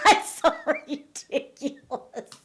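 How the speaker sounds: slow words through real laughter; laughing in disbelief. Real, not acted. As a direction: laugh it off as absurd. Mocking Dorit's claim.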